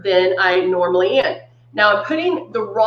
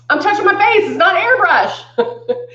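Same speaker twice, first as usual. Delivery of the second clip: laughing; 8 semitones higher than usual. Real, not acted. Laughing at her mistake.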